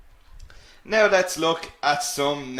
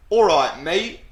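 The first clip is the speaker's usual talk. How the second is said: English-accented greeting, louder, rising high on "mate"; 3 semitones higher, 6 dB louder than usual. Shown as a demonstration. Teacher showing what you'll hear them say, English accent. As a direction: hail a friend in an English accent. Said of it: "you'll often hear them say".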